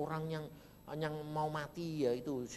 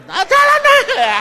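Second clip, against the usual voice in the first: very loud, high scoffing dismissal; mocking dismissal; 24 dB louder than usual. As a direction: scoff at the idea loudly. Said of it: "jangan bilang". (mengejek dengan keras dan tinggi)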